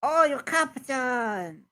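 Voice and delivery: high, slow gasp of realisation; gasp, then draw out the discovery; awed kobold. Acted. Kobold realises it has met the captain, awed surprise.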